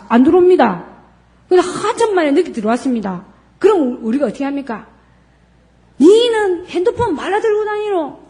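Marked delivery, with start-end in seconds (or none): 6.0-8.2 high-pitched scolding parent; mother scolding her late child. Shown as a demonstration. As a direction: tell off a kid who came home late. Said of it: "이렇게 야단치겠죠".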